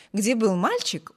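plain reading voice

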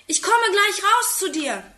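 put-on high, eager child's call. A narrator's reading. Boy calls to his friend outside, high eager call. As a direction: shout it out the window, excited. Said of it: "ruft er aus dem Fenster".

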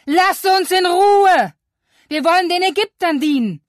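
very high, loud, bitter shouting; angry Israelites. Acted. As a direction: shout back in angry despair. People shouting at Moses.

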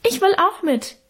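put-on very high piping child's voice; high squeak of a small brother wanting in; squeaky little boy. A narrator's reading. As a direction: pipe it up like a tiny boy. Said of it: "piepste unser kleiner Hermann".

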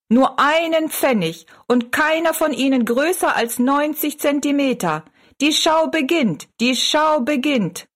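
high-pitched fairground barker; showman calling out. A narrator's reading. Showman invites people in as the show starts, high barker's call. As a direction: tout the show in a high, carrying chant. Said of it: "lud die Leute zum Eintreten".

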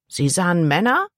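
rising, innocent question; questioning boy. A narrator's reading. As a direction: ask it back with the pitch climbing. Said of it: "fragte der Junge". (fragend, mit steigendem Ton)

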